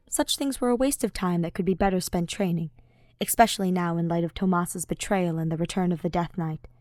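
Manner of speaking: usual speaking voice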